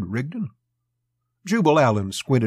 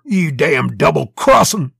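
loud, slow, furious accusation; angry swearing; 4 semitones higher, 9 dB louder than usual. A narrator's reading. Vorton faces Rigdon in the street, swearing angrily. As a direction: curse him out, drawn out and loud. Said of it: "swore. he began".

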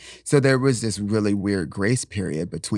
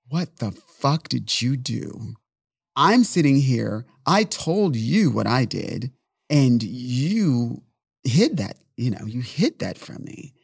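louder, higher, slow furious accusation; retold angry outburst; 5 semitones higher, 5 dB louder, slower than usual. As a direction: relive the rage at the boyfriend. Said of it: "I got so angry".

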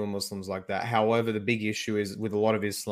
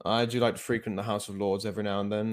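lounge in it like a snooty lord; posh British accent; 5 dB quieter than usual. Pretend. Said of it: "the more refined accent of the upper class".

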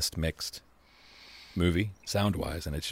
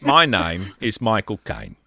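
slow, loud mock British voice; Michael Caine impression; 7 dB louder, slower than usual. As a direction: announce yourself like Michael Caine. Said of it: "Everyone do their best Michael Caine impression".

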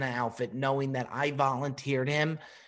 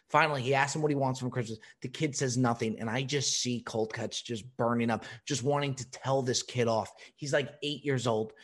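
ordinary talk, nothing marked

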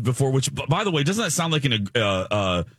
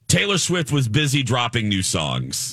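upbeat on-air host intro; bright teaser before the break; radio host voice; 5 semitones higher than usual. Acted.

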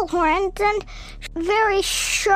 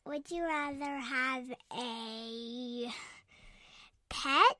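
quiet; 17 dB quieter than usual. Real, not acted. Quiet chat after show.

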